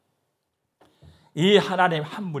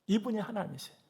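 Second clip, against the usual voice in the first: ordinary talk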